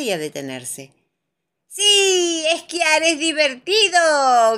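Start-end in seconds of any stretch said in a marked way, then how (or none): 1.7-4.6 grito agudo y alargado de diversión; grito alegre. A narrator's reading. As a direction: grita fuerte, como niños esquiando. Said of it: "gritan todos". (gritando con entusiasmo)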